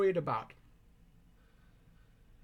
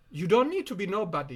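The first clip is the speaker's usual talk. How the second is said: ordinary delivery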